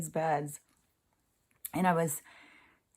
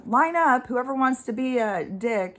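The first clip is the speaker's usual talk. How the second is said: mock calling out; 6 semitones higher, 4 dB louder than usual. Mock call to line up abusers.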